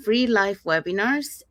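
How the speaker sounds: normal talking voice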